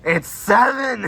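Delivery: high, slow teasing yell; playful shout. Pretend. Playful countdown shouting at friend.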